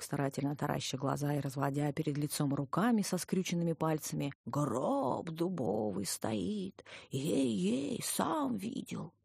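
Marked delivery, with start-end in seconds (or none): 4.5-9.1 very slow, drawn-out spooky wail; old man swearing to his ghost story; wailing storyteller. A narrator's reading. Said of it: "завывающим голосом вещал дедок". (протяжно, с жутким завыванием)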